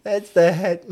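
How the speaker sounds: usual speaking voice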